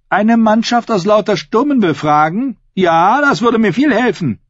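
high, indignant character voice; scornful sarcasm. A narrator's reading. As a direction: scoff at the useless offer, pitched high. Asha scornfully rejects uncle's offer. (höhnisch, mit hoher empörter Stimme)

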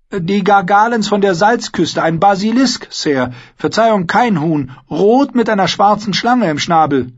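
high, loud squire's voice; boy proudly reciting heraldry; confident young squire. Acted. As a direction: correct him eagerly and respectfully.

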